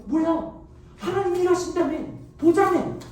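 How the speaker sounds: sarcastic complaint. Acted. People mock Moses in the crisis.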